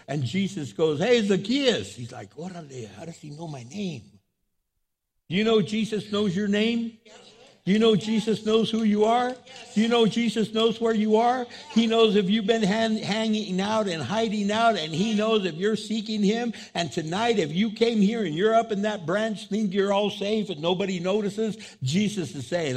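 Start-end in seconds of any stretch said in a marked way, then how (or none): 1.0-1.9 higher, louder hail; calling out. Acted. Jesus spots Zacchaeus in the tree, calling out. 2.3-4.0 much softer, puzzled aside; hushed bewilderment. Acted. Zacchaeus hears Jesus say his name, hushed bewilderment. Startled Zacchaeus wonders to himself. 5.3-21.6 high, forceful sermon delivery; impassioned preaching. Real, not acted. Preacher tells congregation Jesus knows them, impassioned and high.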